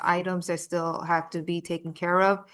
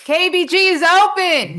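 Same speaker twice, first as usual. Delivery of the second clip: much louder and higher, a big excited proclamation; enthusiastic announcement; 11 semitones higher, 11 dB louder than usual. Shown as a demonstration. Asked to start again, announcing the park opening with big excitement. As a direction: belt it out like grand news. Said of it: "Start again".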